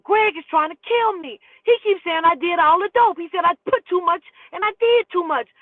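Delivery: high, loud panicky junkie voice; mocking frantic Sam. Roommate insisting Greg wants to kill him, frantic. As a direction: play the paranoid roommate, frantic.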